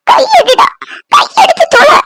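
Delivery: high, urgent snap; angry order. Acted. Woman refuses his help while burning, furious. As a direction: order him off, pitch climbing.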